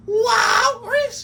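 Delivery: long high shouted call; Walrus call. Shown as a demonstration. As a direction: bellow it out, stretched and rising. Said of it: "the way you used to say his name".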